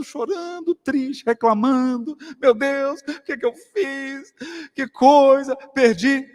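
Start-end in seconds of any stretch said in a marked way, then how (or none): 2.4-6.3 imitating crying lament. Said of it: "tá lá na enfermaria chorando".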